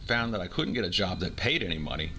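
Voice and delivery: ordinary delivery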